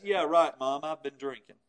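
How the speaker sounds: sarcastic yeah right, dropping low and soft; mock admission that means no. Shown as a demonstration. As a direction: drip with sarcasm so it sounds like a denial. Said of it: "said it in such a way with voice inflection".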